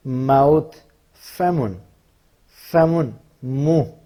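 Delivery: slow, clear word-by-word recitation; vocabulary drill. Shown as a demonstration. Teacher reciting body words to students, slow and clear. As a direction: spell each word out for the class.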